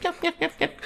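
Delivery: very high, soft mock yipping; squeaky mock snicker; snickering little creep. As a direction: imitate a sneaky little laugh on each yep. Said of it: "Laughing like a little piece of shit".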